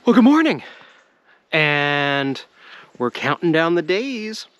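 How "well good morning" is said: bright, loud high hello; cheery greeting. Real, not acted. Vlogger greeting his viewers, loud cheery hello. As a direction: call a big good morning to the camera.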